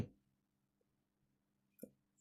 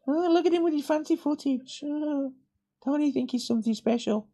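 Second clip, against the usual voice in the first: high sneering mock voice. A mocking viewer sneers at the drone guy in a high voice. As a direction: jeer at the drone vlogger in a high put-on voice. Mocking himself with fancy footage.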